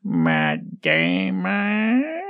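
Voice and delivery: "Matt Damon" hugely stretched, rising at the end; quoted catchphrase voice. Acted. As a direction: drag out "Matt Damon" in a silly put-on voice. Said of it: "I assume that's".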